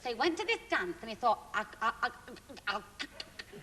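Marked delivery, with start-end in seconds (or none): 1.5-3.6 lightly acted stutter, softer; stuttering man. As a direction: stammer over the first words. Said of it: "he had this terrible stutter".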